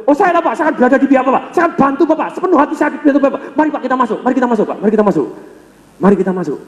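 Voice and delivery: meet his pitch, then bring it down; matching then calming. Retold or imitated. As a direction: teller leads angry customer inside, calming down. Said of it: "langsung diambil".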